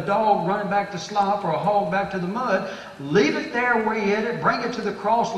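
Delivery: loud, high, driving sermon cadence; full-force preaching. Real, not acted. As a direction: hammer each command home from the pulpit.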